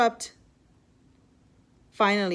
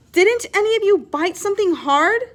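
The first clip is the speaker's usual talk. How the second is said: urgent question; 8 semitones higher, 7 dB louder than usual. A narrator's reading. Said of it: "Maria asked".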